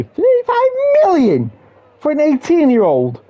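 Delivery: can't believe that fee; stunned disbelief. Real, not acted. Shocked at transfer fee.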